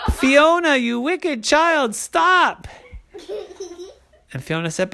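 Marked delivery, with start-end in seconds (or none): 0.1-2.7 high-pitched comic scolding; outraged dad. A narrator's reading. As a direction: cry out in mock outrage, pitched up. Daddy yells at child.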